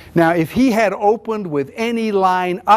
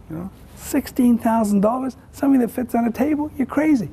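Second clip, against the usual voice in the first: mock outrage at the absurd price, rising higher each line; incredulous scoff; 7 semitones higher than usual. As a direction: pitch jumps way up in disbelief. Scoffing at a $16,000 fudge machine.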